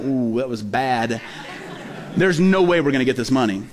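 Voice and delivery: deflated realization it went badly; sinking dread. Pastor at home realizing the sermon flopped, low sinking dread. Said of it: "then I started getting scared".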